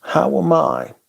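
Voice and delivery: ordinary delivery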